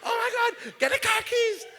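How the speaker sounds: very high, loud panicked shout; panicking wife. Retold or imitated. Frantic wife ordering someone to fetch car keys, panicked high shout. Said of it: "she's panicking".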